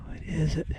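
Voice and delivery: whispered question; breathless treasure hunter. Real, not acted. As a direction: breathe it out in a hush. Hushed over the find.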